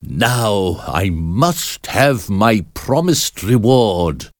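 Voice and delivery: drawn-out, mildly triumphant astrologer; understated triumphant declaration. A narrator's reading. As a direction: claim the prize slowly, with a touch of triumph. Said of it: "shouted the astrologer triumphantly".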